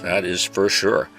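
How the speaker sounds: normal talking voice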